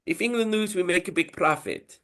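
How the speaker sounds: high mock Italian gangster; hammed-up Italian mobster pitch; Italian accent. Italian accent, mafia boss restating the bet. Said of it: "it would be the same accent".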